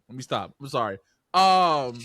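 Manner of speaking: normal talking voice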